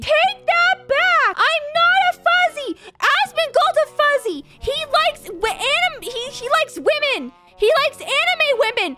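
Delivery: high, loud frantic protest; shrill outraged yelling. Real, not acted. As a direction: scream in panicked denial. Screaming denial, being called furry.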